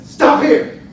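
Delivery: a high, loud command; shouted order. Retold or imitated. Carjacker orders the driver to pull over, shouted command. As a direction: snap out the order to stop.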